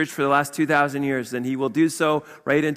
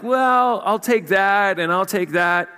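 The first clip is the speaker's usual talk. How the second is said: high, sneering pick-and-choose voice; mock picky believer; 5 semitones higher, slower than usual. Preacher mocks a believer who picks scripture, sneering. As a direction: lightly play someone choosing verses.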